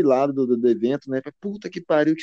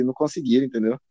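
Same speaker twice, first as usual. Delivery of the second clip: ordinary talk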